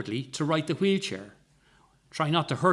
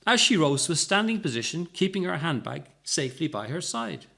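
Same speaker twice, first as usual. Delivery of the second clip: ordinary delivery, nothing marked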